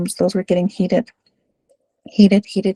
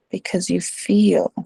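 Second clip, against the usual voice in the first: ordinary talk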